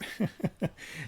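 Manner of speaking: short unvoiced laugh at bad news; breathy chuckle. Real, not acted. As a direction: huff out a little laugh. Reacts to bad weather news.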